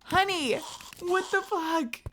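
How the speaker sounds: high, stunned exclamation; shocked. Real, not acted. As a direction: blurt it out in disbelief. Mock outrage at partner.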